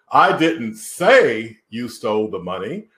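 "say" stretched and punched, the other words lighter; stress on SAY. Shown as a demonstration. Coach showing how emphasis changes meaning, stress on "say". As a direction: lean hard on the word "say". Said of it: "put the emphasis on any of those words".

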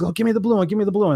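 imitating Les grabbing for the blue one, up high; eager request. Retelling Les picking a bandana.

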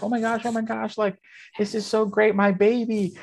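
high, gushing proud mother; gushing mom. Retold or imitated. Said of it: "She was like".

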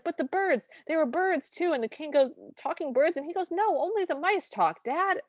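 put-on high, earnest young prince; high insistent prince voice. Prince insisting to his father the king, high voiced.

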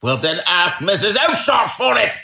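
angry retort. A narrator's reading. Salesman sending the pest to Missus Oakshott, angry retort. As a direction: fling it back angrily. Salesman quarrelling at his stall.

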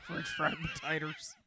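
high, mumbled Sling Blade voice; Sling Blade impression. Mocking her friend's reading, imitating Sling Blade's voice. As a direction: mouth barely open, drawled high Sling Blade. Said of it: "can do a Sling Blade voice".

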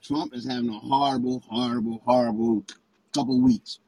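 sounding far away and muffled; like talking through a door. Real, not acted. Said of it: "you sound like you muffled".

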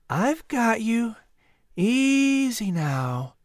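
slow, high, drawn-out calming; soothing boy. Acted. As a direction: soothe a frightened bird, very slowly. Boy gently lifts the eagle.

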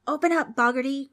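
loud, high-pitched demand; demon's cry. A narrator's reading. Demon calls Boggarty to the door, hollering. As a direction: holler to the man inside. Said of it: "cried one of the demons".

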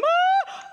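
very high mock yelp of pain; hammed-up high-pitched yelp; imitated child scream. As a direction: let out a child's sharp squeal. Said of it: "and he went from".

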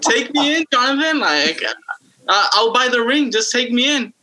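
high mock pleading girl voice; hammed-up desperate plea to Jonathan; begging ex-girlfriends. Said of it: "crying right now".

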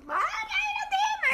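long, very high wail; hammed-up slow shriek; mock screaming. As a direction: imitate a panicking mother, drawing the words right out. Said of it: "מה יעזור לך לצעוק".

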